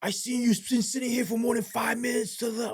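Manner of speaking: high mock voice of a hostile boss; confronting boss. Boss to officer in his car, in a high mocking voice. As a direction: play the harassing boss, pitched well up.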